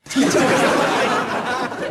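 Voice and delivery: high, breathy burst of laughter; laughing. Real, not acted. Host laughing at his own teasing, real laugh. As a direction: laugh at your own joke.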